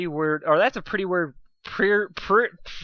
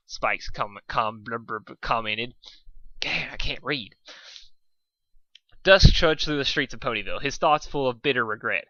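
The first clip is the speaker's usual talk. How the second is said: ordinary delivery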